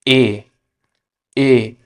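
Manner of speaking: ए said as a pure Spanish "e"; Spanish letter name. Shown as a demonstration. Teacher to students, careful Spanish letter name. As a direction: model ए clearly, then repeat. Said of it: "ई को pronounce करते हैं".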